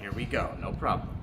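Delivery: whispered, barely voiced aside; whispering. Real, not acted. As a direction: drop to a soft whisper. Teacher aside before the song.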